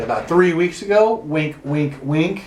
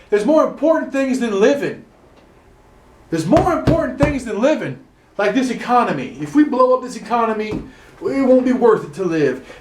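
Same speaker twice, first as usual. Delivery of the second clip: higher, pompous politician voice; satirizing the lieutenant governor's line, higher and mocking; 5 semitones higher than usual. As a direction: parody a smug official defending the economy. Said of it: "the lieutenant governor of Texas is the one that said".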